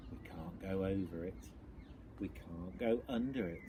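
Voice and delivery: plain reading voice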